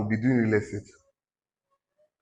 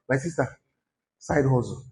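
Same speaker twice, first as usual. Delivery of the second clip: ordinary talk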